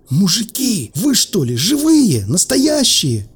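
high-pitched, slow, drawn-out disbelief; incredulous hopeful question. Acted. Hidden stranger amazed to meet people.

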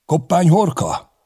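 high, loud call over the field; calling across. A narrator's reading. Knight shouting across the field to Koppány, a loud call. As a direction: hail him from a distance. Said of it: "átkiáltott".

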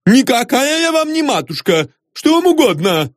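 very high, sharp female voice; high-pitched offended rebuke; indignant wife. A narrator's reading. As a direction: rebuff him haughtily in a high voice. Indignant wife rebuffs the priest.

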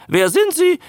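high, loud creature squawk; high-pitched newt question; talking newt. A narrator's reading. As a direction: ask it as a croaking newt. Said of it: "sagte der Molch".

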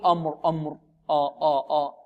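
"أمر" with a thick, dark, backed opening vowel; heavy hamza, wrong. Shown as a demonstration. Teacher shows students the mistake, heavy hamza. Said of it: "نفخم الهمزة. خطأ".

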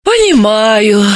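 slow sighed word; sighing. Acted. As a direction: breathe it out heavily. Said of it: "вздохнула Далила".

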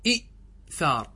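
إئثار said slowly with both glottal stops; teacher voicing the original form إئثار; two hamzas sounded. Shown as a demonstration. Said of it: "أصله".

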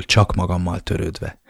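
plain reading voice

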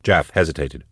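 usual speaking voice

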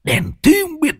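cursing the bad luck, rising high; grumbling curse that flares up; annoyed cursing. Old Thoạt cursing the intruder who ruined his hand, irritated. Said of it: "Lão bực bội, vứt bài xuống, mặt cau có".

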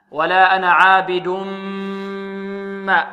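ولا أنا عابد ما with a long nasal m hum; ghunnah. Shown as a demonstration. Tajweed teacher models idgham, sustained nasal hum. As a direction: merge the end of عابد into a held m of ما. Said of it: "غنة".

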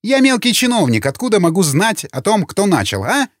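high-pitched, exasperated self-defence; irritated retort. A narrator's reading. Official defends himself to Morgan over his words, irritated. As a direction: push back testily, pitch riding high.

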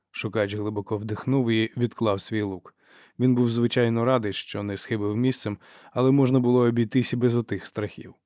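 ordinary delivery

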